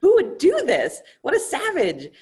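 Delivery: high, loud shocked outrage at a defiled altar; outraged priest. Acted. Brahmin priest finds altar bloodied, high shocked outrage. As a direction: priest horrified to find blood on his altar, shrieking. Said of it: "is like".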